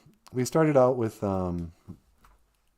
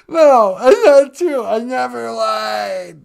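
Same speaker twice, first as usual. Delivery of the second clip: loud whiny denial; mock tearful denial; 7 dB louder than usual. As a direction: protest through fake tears. Caller denies lying.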